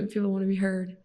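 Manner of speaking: ordinary talk, nothing marked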